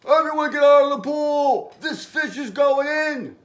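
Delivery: high, loud shouted order; firemen's yell. A narrator's reading. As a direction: shout a warning to clear everyone out. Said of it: "The firemen yelled".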